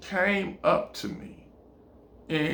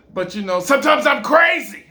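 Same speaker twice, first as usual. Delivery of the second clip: manic outburst; 7 semitones higher, 7 dB louder than usual. Acted. She excuses pulling the knife.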